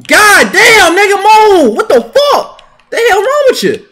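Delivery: high, loud yelling at a teammate; angry yell. Real, not acted. Gamer yelling at teammate in his way, furious. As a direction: scream it out in frustration.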